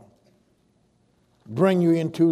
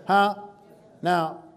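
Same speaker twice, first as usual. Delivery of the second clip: normal talking voice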